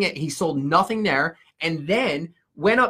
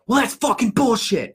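retold outburst, pitched up high; mildly raised, higher quoted outburst; mock outburst; 7 semitones higher, 4 dB louder than usual. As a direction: imitate a speaker going off at the crowd. Retelling speaker's outburst to crowd.

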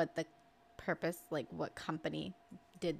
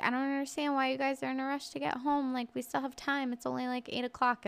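mother-in-law voice; 6 semitones higher than usual. Retold or imitated. Mister J's mom voiced.

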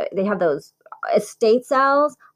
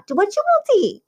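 higher, lightly Italian mother's voice; a hint of Italian mom; 11 semitones higher than usual. Guest joins imitating an Italian mother, high and light. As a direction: urge them to eat, Italian-style.

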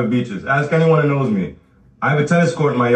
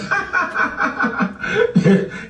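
high wheezing cackle; mocking laughter; 3 dB quieter than usual. Real, not acted. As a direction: burst out laughing at them. Ridiculing the viewer's mortgage.

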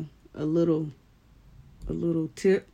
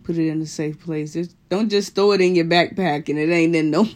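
ordinary talk

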